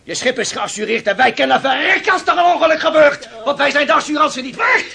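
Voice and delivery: fel en hoog uitgeroepen verwijt; woedend. Acted. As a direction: schreeuw je woede naar de baas. Ruzie van visser met reder. (woedende visser tegen de reder over de verzekering)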